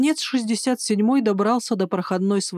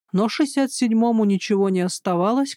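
ordinary delivery

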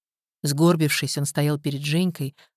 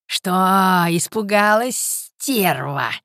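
raised, drawn-out malicious sneer; menacing taunt; 3 semitones higher, 5 dB louder, much slower than usual. A narrator's reading. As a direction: taunt her slowly, falling on the insult. Mad old man taunts frightened woman. (злобная протяжная издёвка)

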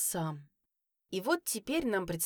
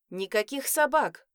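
louder, firm angry refusal; 4 semitones higher, 7 dB louder than usual. A narrator's reading. Mother angrily refusing the kids a dog, louder firm tone. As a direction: cut the argument off, annoyed. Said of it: "разозлилась я".

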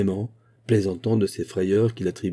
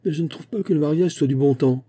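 sneering; 6 semitones higher than usual. A narrator's reading. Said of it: "ricana Mick Inwood".

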